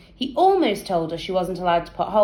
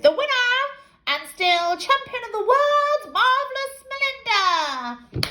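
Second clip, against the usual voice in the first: bellowed. Acted. Girl announcing the fight winner, bellowing like a ring announcer. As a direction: announce the champ to the crowd. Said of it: "She bellowed".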